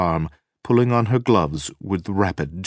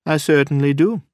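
loud, high, insistent reply; emphatic; 10 semitones higher, 7 dB louder than usual. A narrator's reading. Wife answering whether she feels like going out, emphatic. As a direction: declare it with force. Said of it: "Nina said emphatically".

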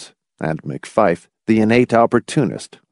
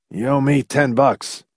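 sickly mutter; 4 semitones higher than usual. A narrator's reading. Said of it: "McFife muttered sickly".

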